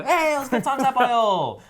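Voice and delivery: high, loud playful shout at strangers; mock battle cry. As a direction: belt it out like a trainer challenging rivals. Retold Pokemon Go street encounter.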